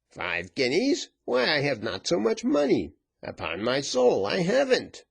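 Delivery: dismayed, pitch leaping high; high-pitched plea of empty pockets; protesting lieutenant. A narrator's reading.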